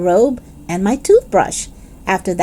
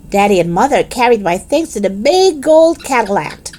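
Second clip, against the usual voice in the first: plain reading voice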